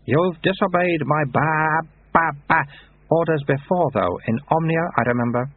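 put-on high, childlike abbot voice; baby abbot. A narrator's reading. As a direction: speak like a toddler with an old man's words.

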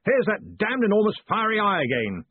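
high, forceful bellowed complaint; bellowing. A narrator's reading. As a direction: bellow it in exasperation. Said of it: "he bellowed".

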